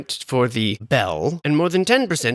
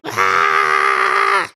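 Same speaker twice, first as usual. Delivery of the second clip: startled shout; 10 semitones lower, 4 dB louder than usual. Pretend. House 'screaming' at him.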